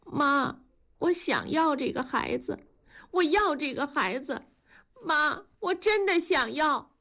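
high-pitched, insistent plea; tearful pleading. A narrator's reading. Daughter-in-law pleading to keep the baby, tearful.

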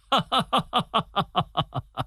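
high staccato stage laugh, slowly falling; hearty big laugh. A narrator's reading. General laughing at his guest after boasting, big laugh. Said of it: "说完大笑起来".

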